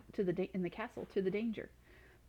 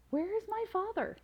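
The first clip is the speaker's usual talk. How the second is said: put-on much higher alarmed boy's cry; frightened cry; 13 semitones higher than usual. A narrator's reading. Son finds father gone, crying out in fright. Boy sees father turned dragon.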